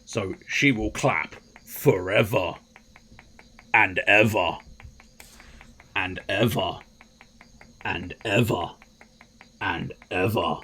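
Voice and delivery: slow, drawn-out repetition with long pauses; mock dramatic zoom. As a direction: space out each phrase dramatically. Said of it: "thus emulating a zoom".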